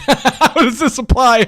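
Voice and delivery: amused disbelief through laughter; laughing. Real, not acted.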